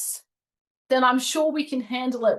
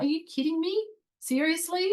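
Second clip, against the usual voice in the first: pitched way up, incredulous; exasperated disbelief; 9 semitones higher than usual. Retold or imitated. Flat tire again on the roadside, pitched-up exasperation. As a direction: throw it up high in disbelief. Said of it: "I'm like".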